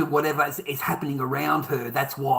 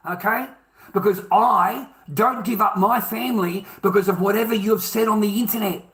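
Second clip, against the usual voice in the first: heated, raised-pitch outburst; real angry rant; 3 semitones higher than usual. Real, not acted. Telling relatives we aren't family over internet posts, angry. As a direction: lay into them with real anger. Ranting at relatives who criticised him.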